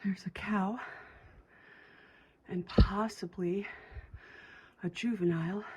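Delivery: whispering, breathing heavy after a hike; breathless hushed whisper. Real, not acted. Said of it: "Sorry, I'm breathing heavy".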